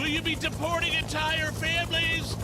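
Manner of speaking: very high, put-on accented press question; hammed-up foreign reporter shout; accented screaming reporter. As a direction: yell it up high over a helicopter. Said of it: "with that accent".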